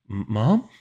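high, faltering question; uncertain, cracked little call; voice cracking. A narrator's reading. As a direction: stammer it, voice cracking upward. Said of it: "My voice cracked".

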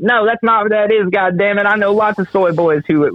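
mock objection. Imitates an objecting listener.

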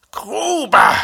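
high, loud drawn-out roar; bellowed, voice cracking. A narrator's reading. NCO roaring at a cadet who ignores him, bellowing. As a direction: roar the name until the voice tips over. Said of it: "brüllt der Unteroffizier, und die Stimme schlägt ihm über".

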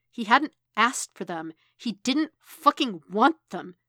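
emphatic, exasperated narration; mounting frustration, leaning hard on the words; frustrated. A narrator's reading. Narrator voices the character's frustration.

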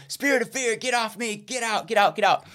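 high, strained mock rebuke; retold deliverance prayer. Student praying against spirit of fear, high mock-urgent rebuke. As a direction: reenact casting out a spirit. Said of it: "praying, you know".

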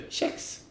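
"kex" starting on tje, not K; soft tje-sound. Shown as a demonstration. Guest concluding the spelling rule, tje in "kex". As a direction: say "kex" like "tjex". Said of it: "det följs ju av en mjuk vokal".

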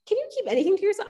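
high-pitched, rapid mock exasperation; exasperated. Co-host reacting to a cast member's oversharing, exasperated.

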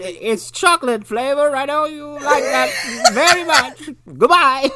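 high, slow granny; old lady voice. Retold or imitated. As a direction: hand over the cereal sweetly like a granny.

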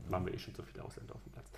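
quick whispered repeat; whispered aside. Retold or imitated. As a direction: mutter it under your breath, fast. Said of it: "und ich so".

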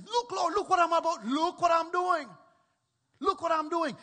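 put-on showing off his giving like a delighted child; excited. Zacchaeus showing the Lord his giving, thrilled. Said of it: "What he's doing is he's excited".

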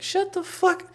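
extremely high, strained squeal; retold angry retort. Silencing tattling brother, high-pitched mock fury. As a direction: cut the snitch off in a mock shriek.

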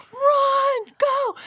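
high, loud run call; frantic high run go; urgent shout. Retold or imitated. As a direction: yell to flee now. Fleeing with stolen device.

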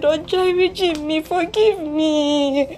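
high, sobbing pleas with laughing cries; tearful apology. Acted. Older sister begging younger sister's forgiveness, sobbing and pleading. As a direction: beg forgiveness through tears, pitch up.

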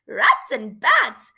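loud, drawn-out exclamation; punchy, stretched exclamation; exclaiming. Acted. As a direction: call it out slowly and loudly. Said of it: "Shivrin exclaimed".

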